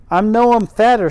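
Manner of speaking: high, loud girl protesting in faux Yorkshire; faux Yorkshire accent. Mary retorting to the gardener, loud faux Yorkshire. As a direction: pipe up in a put-on Yorkshire. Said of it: "Mary has my terrible faux Yorkshire accent".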